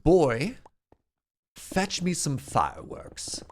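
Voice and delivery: imperious mock English accent; best English accent. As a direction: put on a grand English accent, drawn out. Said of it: "do my best English accent".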